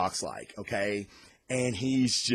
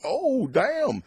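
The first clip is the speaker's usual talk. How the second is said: high, sudden gasp of shock; mock jaw-drop; stunned reaction; 12 semitones higher, 3 dB louder than usual. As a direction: react like the twist just hit you. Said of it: "you're like".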